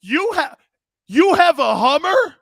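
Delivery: high, loud, hammed-up disbelief; mock outrage that the caller drives a Hummer; mock shocked. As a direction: gasp it out in fake horror. Shocked snowflake in the skit.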